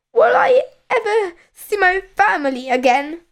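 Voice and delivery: high, quick wistful thought; plaintive boy's inner voice; worried boy. A narrator's reading. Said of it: "thought Percy".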